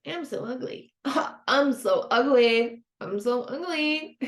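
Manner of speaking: goofy singsong voice, laughing through it; mock your own inner critic playfully; silly funny voice. Shown as a demonstration. Therapist demos mocking a negative thought, silly voice. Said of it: "saying it out loud in a funny voice".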